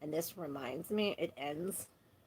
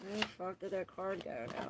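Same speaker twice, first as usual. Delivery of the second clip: ordinary talk, nothing marked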